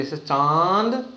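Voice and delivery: चांद drawn out slowly with a nasal vowel; nasal demo. Shown as a demonstration. Teacher showing the nasal sound in चांद, slowly. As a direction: draw out चांद slowly, letting the vowel ring through the nose. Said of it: "मुंह और नाक से साथ में आवाज आएगी".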